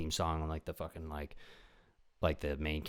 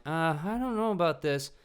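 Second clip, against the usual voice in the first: higher, timid worried voice; nervous movie friend; 8 semitones higher than usual. Retold or imitated. Wary friend warning about the danger sign, timid and higher. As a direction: hesitate like the scared sidekick. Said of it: "they're like".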